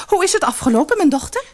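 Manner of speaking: high, loud eager mother; eager call. Acted. Mother calling to returning daughter, high and eager. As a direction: call out hopefully. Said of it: "riep haar moeder".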